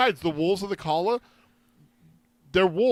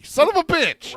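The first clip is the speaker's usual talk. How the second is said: a higher, louder "Son of a bitch"; real frustrated outburst; 13 semitones higher, 8 dB louder, slower than usual. Real, not acted. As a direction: blurt it out, annoyed at yourself. Realizes he was wrong.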